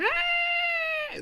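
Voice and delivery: very high, thin sustained mock scream; hammed-up girlish shriek, held long; wimpy scream. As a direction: imitate a wimpy, drawn-out high-pitched scream. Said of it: "lets out this bitch-ass scream".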